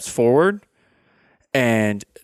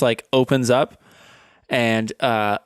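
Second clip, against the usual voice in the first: ordinary talk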